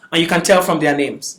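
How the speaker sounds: ordinary delivery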